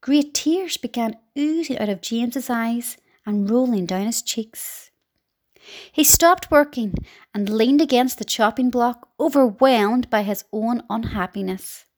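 usual speaking voice

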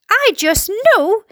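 high, slow, drawn-out insistence; high-pitched storybook panic; screamed alarm. A narrator's reading. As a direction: insist in horror, stretching each word. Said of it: "screamed the head of the fire department".

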